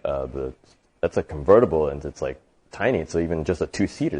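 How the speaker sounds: low, halting objection; hesitant mechanic. Acted. Mechanic objecting to rich customer's request, hesitant.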